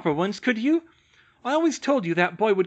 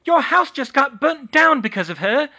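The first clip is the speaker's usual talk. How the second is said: high, loud scornful tirade; spiteful rant; 13 semitones higher, 9 dB louder than usual. Acted. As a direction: spit insults at the girl. Muriel ranting at Hermione.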